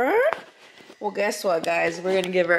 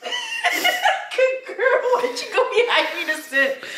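high laughing praise; slower than usual. Real, not acted. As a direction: laugh through the words at the silly dog. Dog sits in wrong spot.

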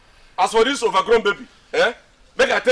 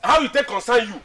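raised, higher voice; indignant challenge; 3 semitones higher than usual. Acted. Quarrel, telling friend off.

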